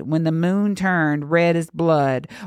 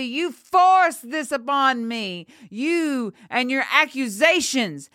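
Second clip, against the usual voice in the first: furious accusation; 9 semitones higher than usual. Acted. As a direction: throw the blame at them in a raised pitch. Enraged girl accuses the priest.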